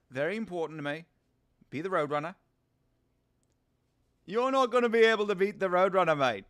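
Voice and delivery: South African accent impression; Dricus. Streamer playing the fighter as Road Runner, South African accent. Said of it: "that's, that's not a bad Dricus".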